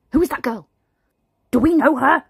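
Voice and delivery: nosy alarm. Acted. Mother spotting son talking to a girl, alarmed. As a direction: demand to know who she is.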